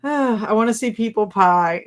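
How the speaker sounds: ordinary talk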